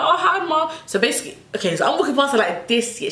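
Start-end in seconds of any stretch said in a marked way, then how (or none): 0.0-0.7 high sing-song greeting; fake cheerful. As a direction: chirp it with a laugh. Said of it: "I'm like".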